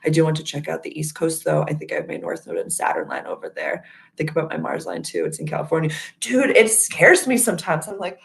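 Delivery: normal talking voice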